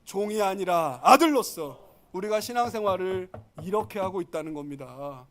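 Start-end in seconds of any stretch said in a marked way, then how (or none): 0.1-1.8 slow slogan, punched loud; congregational greeting. Shown as a demonstration. Preacher has neighbours greet each other, declaiming. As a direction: lead the room in it, slowly and firmly. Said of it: "우리 좌우에 인사합시다".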